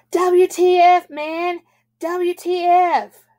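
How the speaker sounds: loud, high-pitched holler; high, drawn-out yell. A narrator's reading. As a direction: holler it out at no one in particular. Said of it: "started hollering at no one in particular".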